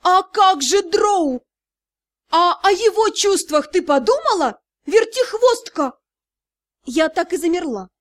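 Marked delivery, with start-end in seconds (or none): 0.0-5.9 hissed scolding. A narrator's reading. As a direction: scold her like a scandalized matron. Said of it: "прошипела".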